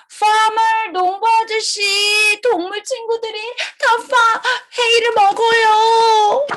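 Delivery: high, urgent call; calling the farmer. Acted. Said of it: "we need to find the farmer first".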